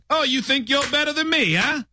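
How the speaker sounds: high, put-on tough-talk voice; terrible impression. Host hints at a famous actor, high bad impression. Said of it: "Terrible impression".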